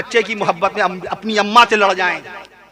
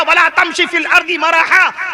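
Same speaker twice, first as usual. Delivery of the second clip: 1 Quranic recitation; 5 semitones higher, slower than usual. Real, not acted. Quranic verse recited in sermon.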